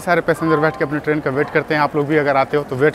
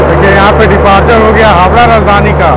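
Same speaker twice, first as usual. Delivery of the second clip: loud over train noise; 3 semitones higher, 10 dB louder than usual. Real, not acted. Calling over departing train noise.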